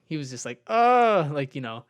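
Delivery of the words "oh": a loud, high imitated groan, not a scream; mock pained groan. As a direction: ham up a teammate's pained "oh". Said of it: "Not screaming".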